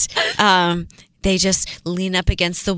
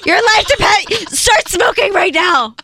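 higher, slow insistent plea; pushy pleading; 9 semitones higher, 4 dB louder than usual. Acted. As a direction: push hard, almost begging. Pushy persuasion game.

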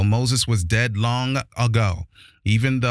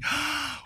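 mock gasp; 8 dB quieter than usual. Mock shock at the Pharisees.

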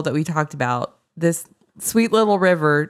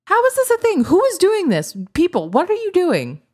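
high-pitched, baffled exclamation; astonished, voice shooting up; incredulous; 10 semitones higher than usual. Real, not acted. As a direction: demand answers in amused disbelief. Baffled at city sound websites.

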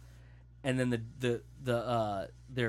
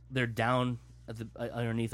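ordinary delivery

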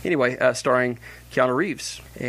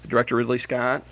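ordinary talk

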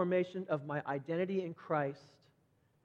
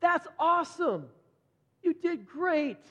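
high, loud cheering encouragement; encouraging praise; 9 semitones higher, 4 dB louder, slower than usual. Pretend. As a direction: cheer on a newer believer warmly. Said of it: "look back at them and say".

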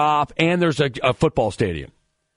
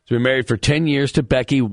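ordinary delivery

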